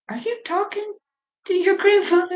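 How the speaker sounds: high, soft grandma voice; grandma asking the girl about grandpa; grandmother's voice. Retold or imitated.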